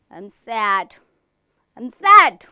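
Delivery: pouting sad, ending in a loud wail; sulk, then wail the last I'm sad; sulky sad. Shown as a demonstration. Teacher showing the class a sad pout, wailing. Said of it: "throw something on the floor and say".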